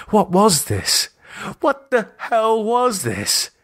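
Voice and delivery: a bound man's frantic thought, panicking; panicked disbelief. Acted. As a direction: push the pitch up into raw panic. Captive realizes he is trapped.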